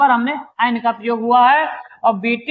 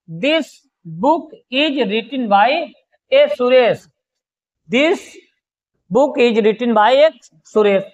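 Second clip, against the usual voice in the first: slow, word-by-word example repeated; slow dictation; much slower than usual. Shown as a demonstration. Teacher dictating the example to students, slow. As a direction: spell it out slowly for the notebooks. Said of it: "जैसे".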